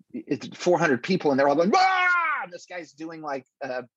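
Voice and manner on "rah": a high yelled roar like a rowdy mob; crowd roar. Retold or imitated. Comic retelling a rowdy club crowd, high mock roar. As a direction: become the angry crowd for one yell.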